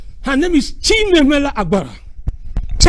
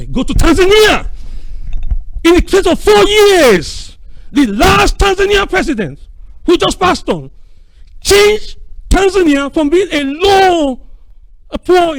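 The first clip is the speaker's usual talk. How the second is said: raised rallying voice; 6 semitones higher, 4 dB louder, slower than usual. Real, not acted. Impassioned rallying to the audience.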